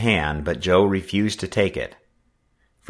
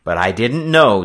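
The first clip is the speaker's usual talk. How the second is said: a louder, earnest objection; protesting; 3 semitones higher, 7 dB louder than usual. A narrator's reading. Boy answering the bullying skipper's accusations, protesting. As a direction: protest your innocence firmly. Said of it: "Joe protested".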